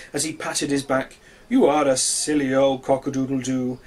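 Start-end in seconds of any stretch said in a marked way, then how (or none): 1.5-3.8 louder, fond teasing voice; teasing sun. A narrator's reading. The sun teases the coughing rooster, loud and fond. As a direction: tease him again, fondly. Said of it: "said the sun".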